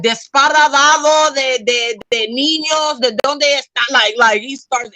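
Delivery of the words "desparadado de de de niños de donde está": husband's Spanish. Retold or imitated. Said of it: "he's like".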